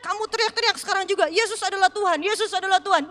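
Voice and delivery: loud, fast ordering shout; commanding shout. Retold or imitated. Preacher imagines ordering the hypnotist to shout. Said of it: "suruh teriak-teriak".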